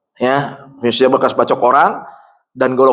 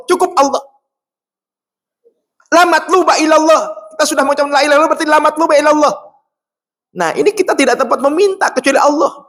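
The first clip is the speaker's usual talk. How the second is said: preaching at full force; 14 semitones higher, faster than usual. Acted. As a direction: lift it up and proclaim to the crowd. (khotbah lantang penuh semangat)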